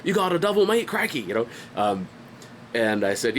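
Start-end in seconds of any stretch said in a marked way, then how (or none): 0.0-1.2 hammed-up Australian accent, pitched high; mock Australian, questioning lift on "mate". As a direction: do an Aussie bloke, higher than your own voice. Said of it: "That's my Australian accent".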